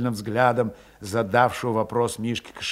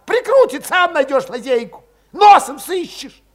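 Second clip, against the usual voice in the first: high, loud exasperated retort; irritated snapping; 13 semitones higher, 8 dB louder than usual. A narrator's reading. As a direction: throw the question back angrily. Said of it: "окрысился вдруг Валет".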